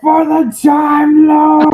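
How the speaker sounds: high, drawn-out heroic yell; screamed battle cry. Acted. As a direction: cry it out slowly as you charge. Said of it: "just sort of scream".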